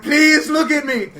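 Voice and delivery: loud slow pleading cry; desperate yell. Retold or imitated. Comic onstage begging ignoring crowd, loud slow plea. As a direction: beg the room to turn around, full volume. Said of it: "I'm just up there like".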